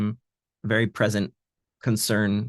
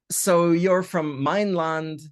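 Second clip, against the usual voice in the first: mein Land drawn out very slowly; slow, stressed mein Land; 6 semitones higher, slower than usual. Shown as a demonstration. Translator explaining the pun, slowly stressing mein Land. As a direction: spell out mein Land word by word. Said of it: "some sort of joke here".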